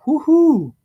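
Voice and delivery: high, whooping little celebration; excited cheer. Real, not acted. Streamer cheering to chat about his car stereo, whooping. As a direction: whoop it up over the new stereo.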